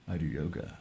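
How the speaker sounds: quiet, smug and pompous. Retold or imitated. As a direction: say it like you're sucking a lemon. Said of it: "they go".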